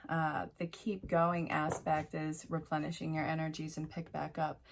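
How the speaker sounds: ordinary talk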